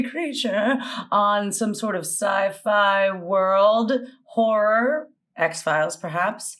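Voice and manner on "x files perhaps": ordinary delivery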